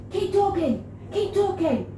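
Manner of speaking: high mock calling; hammed-up, frantic high voice; urgent boss. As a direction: imitate him insisting, pitched right up. Rob calling, back turned to her.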